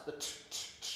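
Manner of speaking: whispered "t, t, t" held alone; "t" spoken on its own, unvoiced and clipped off; t sounds. Shown as a demonstration. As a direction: isolate the "t" as a bare, voiceless tap. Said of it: "alliterates with the first two sounds".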